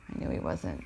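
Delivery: quietly repeating it to herself; soft low echo. Real, not acted. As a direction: let "I knew he wasn't" sink to a low murmur. Low, much softer echo of the line.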